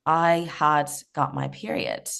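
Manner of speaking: ordinary talk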